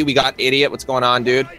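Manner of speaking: ordinary talk, nothing marked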